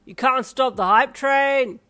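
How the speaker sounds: loud, drawn-out dad holler; exuberant shout. A narrator's reading. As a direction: shout it proudly to the whole crowd. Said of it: "Darby shouted".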